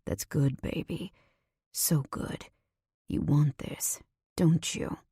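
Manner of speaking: lightly voiced commanding man, murmured; rumbling male voice. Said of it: "His voice rumbles".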